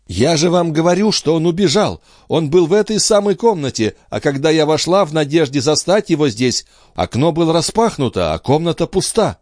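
high, insistent female character voice; agitated mother. Acted. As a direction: insist in distress, pitched high. Андре в отчаянии объясняет. (взволнованная мать, высоко и настойчиво)

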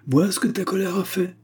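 high-pitched scolding lament; shrilly pitched blame; reproachful. A narrator's reading. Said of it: "d'un air de reproche".

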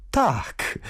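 a high, glad yes; light up with joy; delighted. A narrator's reading. Queen Jadwiga pleased at the question, delighted yes. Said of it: "ucieszyła się Jadwiga".

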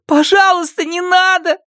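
high crying shout; tearful pleading. Acted. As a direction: beg through sobs. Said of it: "умоляла Ада".

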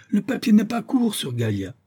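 high-pitched agitated objection; frantic, shrieky insistence; exclaimed protest. A narrator's reading. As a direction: squawk it out in alarm. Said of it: "s'écria Isaac Acabut".

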